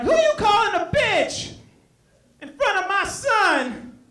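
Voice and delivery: high-pitched indignant challenge; acted high, scandalized outrage; outraged mother. Acted offended mother confronting him.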